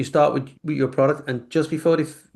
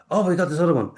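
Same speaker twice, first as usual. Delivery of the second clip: amused quote, higher and laughing; 5 semitones higher, faster than usual. Retold or imitated. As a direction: act out the realization with a laugh. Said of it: "sorry, I'm laughing because".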